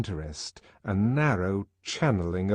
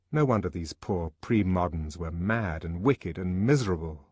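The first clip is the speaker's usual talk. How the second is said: usual speaking voice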